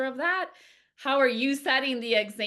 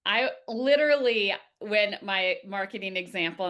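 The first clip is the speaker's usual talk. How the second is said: ordinary talk, nothing marked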